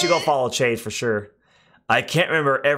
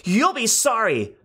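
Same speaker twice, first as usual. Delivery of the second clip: angry fairy; 10 semitones higher than usual. Acted. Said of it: "the fairy grew pink with anger".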